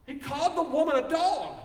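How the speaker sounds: high, loud, outraged exclamation; indignant outburst. Real, not acted. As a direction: throw the shock at the congregation. Preacher stressing the outrage.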